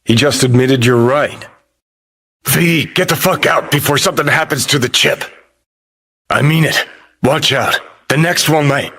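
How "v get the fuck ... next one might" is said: urgent warning. Acted.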